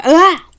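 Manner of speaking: sharp high loud "Ah!" cut off suddenly; yelp. Acted. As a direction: yelp in fright. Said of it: "Haley yelped in her wolf form".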